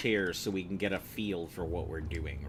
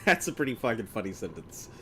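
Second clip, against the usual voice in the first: usual speaking voice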